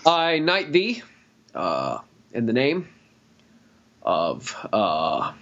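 a lightly done, slow, pausing Obama at a podium; Obama impression. Joking about knighting Gary, a halting Obama-style thank-you. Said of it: "I can't do an Obama impression".

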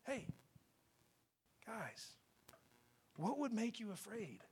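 very soft, near-whispered questioning; gentle reassurance. Acted. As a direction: coax them quietly, without blame. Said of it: "What I see is".

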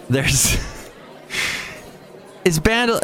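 usual speaking voice

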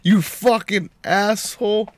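usual speaking voice

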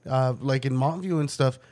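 normal talking voice

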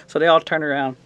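usual speaking voice

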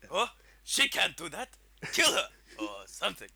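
loud hammed-up villain order; mock villain. Friend voicing Krennic, loud mock order. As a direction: toss off a lazy villain order.

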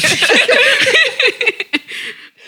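high, loud laugh; burst of laughter. Real, not acted.

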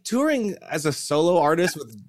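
ordinary talk, nothing marked

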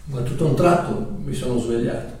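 quiet preaching voice; hushed sermon narration. Acted.

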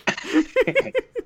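a shrill burst of laughter; high squealing laugh. Real, not acted. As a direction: laugh up high at the sudden cut. Laughing at abrupt subject change.

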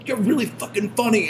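high-pitched excited fan yell, imitated; mock yelling. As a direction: imitate a fan calling across a hotel. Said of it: "starts yelling across the entire hotel".

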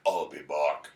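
low-pitched action hero catchphrase; Arnold Schwarzenegger. Acted. Host pitching a movie spoof, low action-star impression. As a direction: do an iceberg as the deep Austrian star. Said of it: "Arnold Schwarzenegger".

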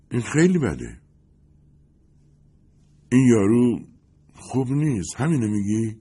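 a hint of a starlet, pushing for gossip; Lindy's voice.

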